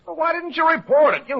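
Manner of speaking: high, heated demand; angry outburst. Acted. Lieutenant confronts Brad over the unreported body, angry and high. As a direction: blow up at him for hiding it. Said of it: "Calm down".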